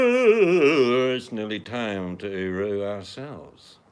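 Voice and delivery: booming, drawn-out announcement; slow and loud, like a herald; grand slow proclamation. Pretend.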